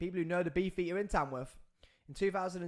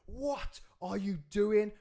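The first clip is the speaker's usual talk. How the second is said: higher, louder, slow incredulous shout; retold shout; 7 semitones higher, 5 dB louder, slower than usual. Pulling the attacker off the beaten man, slow outraged shout. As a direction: yell it at the attacker as you shove him away.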